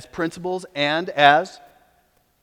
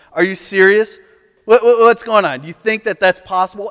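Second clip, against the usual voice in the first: louder, incredulous scoff; mocking disbelief; 3 semitones higher, 7 dB louder than usual. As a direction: jeer at them like they're fools. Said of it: "You hear the language?".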